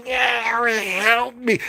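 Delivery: pleading witch. Pretend.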